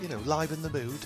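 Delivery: ordinary talk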